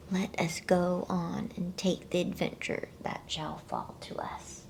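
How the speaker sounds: usual speaking voice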